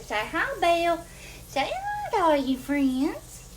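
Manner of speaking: high, loud sing-song coaxing. Real, not acted. Mom coaxing her little one to greet the viewers, sing-song baby talk. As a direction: coax the little one to wave hello.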